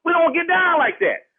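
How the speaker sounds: ordinary talk, nothing marked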